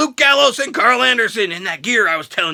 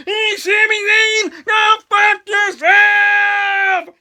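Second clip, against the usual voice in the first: mock shouted challenge; 11 semitones higher, much slower than usual. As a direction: bellow it at Sami like a brawler jumping in. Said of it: "And he's like".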